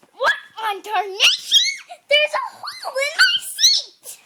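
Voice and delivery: loud, high, hammed-up outrage; mad grandpa. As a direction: shout it slowly like a comically outraged grandpa. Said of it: "Was he mad?".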